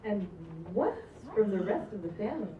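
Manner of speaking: ordinary talk, nothing marked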